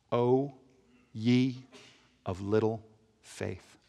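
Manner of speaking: slow, soft, low words of rebuke; solemn. Acted. Preacher reads the verse that struck him, soft and slow. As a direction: let it land slowly and quietly.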